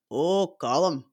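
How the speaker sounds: attempted Northern Irish accent; Derry Girls Irish accent. Shown as a demonstration. Fan quotes Derry Girls from memory, tentative Irish accent. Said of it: "not in their accents".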